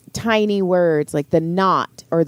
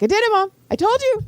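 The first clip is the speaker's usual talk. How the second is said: put-on much higher, triumphant kid yell; excited shout; faster than usual. Teen son telling mom he passed, excited shout. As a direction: burst out with a proud victory cry. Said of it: "And he's so loud".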